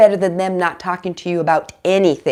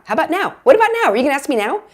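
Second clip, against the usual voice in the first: much higher and louder with each ask; impatient build; 8 semitones higher, 4 dB louder, much faster than usual. Retold or imitated. Parent impatiently waiting to be asked, rising to a high loud push. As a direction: keep pushing, getting more worked up. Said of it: "You're like".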